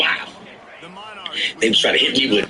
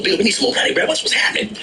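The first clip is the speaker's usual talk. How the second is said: pitched way up, pleading with Bill; mock pleading; 12 semitones higher, faster than usual. Pleading with Bill for more money, high mock whine. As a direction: beg Bill, voice climbing high.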